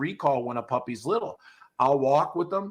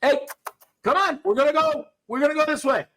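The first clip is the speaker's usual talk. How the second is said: much higher, louder sing-song call; mother dog calling; 11 semitones higher, 4 dB louder than usual. Retold or imitated. Trainer mocking a mother dog calling her pups, much higher and louder. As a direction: call the pups along brightly. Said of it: "She's not like".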